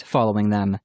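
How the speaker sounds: ordinary delivery, nothing marked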